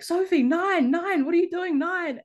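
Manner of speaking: high mock shouted call across the pitch; teammates yelling at her. Teammates calling to the confused sub, mock shouting. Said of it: "everyone's like".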